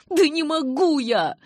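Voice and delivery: high, loud, drawn-out wail; exasperated outburst; desperate shout. A narrator's reading. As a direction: cry out helplessly, pitch way up. Jacob protests again, exasperated.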